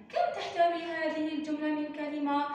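ordinary talk, nothing marked

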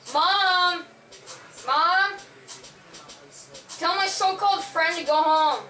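loud calling out; yelling for mom. Real, not acted. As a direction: shout across the house.

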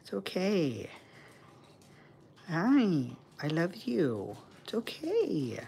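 calming her upset bird with sweet pet talk. Real, not acted.

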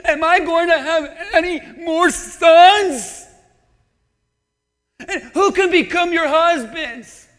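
loud, lamenting Naomi impression, slowed; imitating Naomi. Preacher voicing Naomi to her daughters, loud and lamenting. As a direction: wail it like a bitter old widow. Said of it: "I'm gonna say the way she did".

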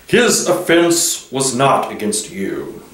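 slow, measured lion's voice; grave lion. A narrator's reading. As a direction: answer slowly, with calm authority. Narrator voices Aslan the lion.

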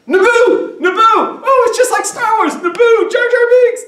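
very high, loud hammed-up squeal; mock fan excitement. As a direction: shriek it like an overexcited fan. Mock fan excitement over Star Wars.

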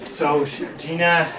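ordinary delivery, nothing marked

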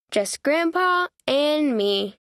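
ordinary delivery

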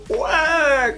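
high, loud mock cheer; excited cheer. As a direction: cheer it like a thrilled fan. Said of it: "ça va faire".

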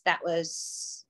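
choked up, halting over the words; fighting back tears. Real, not acted. Speaker recalling helping struggling salon friends, choked up. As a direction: really struggle not to cry, voice catching. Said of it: "I'm gonna really try hard not to cry".

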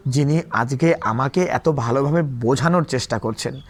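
usual speaking voice

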